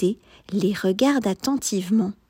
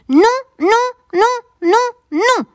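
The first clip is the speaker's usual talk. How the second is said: high, louder whining no's; moaning no; 7 dB louder than usual. A narrator's reading. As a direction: moan in dismay, each no falling. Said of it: "gémit Liam".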